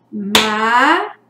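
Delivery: "mata" slowly split as ma, ta; dividida em sílabas. Shown as a demonstration. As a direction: sound out "mata" with a gap between syllables. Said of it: "se eu dividir essa palavra em sílabas". ("mata" separado, sílaba por sílaba)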